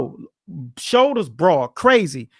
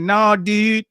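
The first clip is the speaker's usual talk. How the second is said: put-on Baltimore accent; 3 semitones higher than usual. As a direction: throw in a Baltimore accent, calling out. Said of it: "they got that Baltimore accent".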